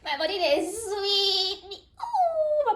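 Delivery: high, loud, drawn-out squeal; thrilled, high-pitched gushing; giddy delight. Real, not acted. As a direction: squeal slowly with pleasure, breaking into a laugh. Overjoyed at the revealed gift.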